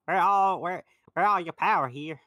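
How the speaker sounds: put-on Southern accent. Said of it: "She's Southern".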